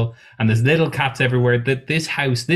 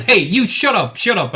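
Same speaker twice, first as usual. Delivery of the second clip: high, louder snap of shut up; May snapping; 9 semitones higher, 3 dB louder than usual. Acted. May yells at a booth in the corner, high and sharp. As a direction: bark it at the rowdy booth. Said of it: "And she goes".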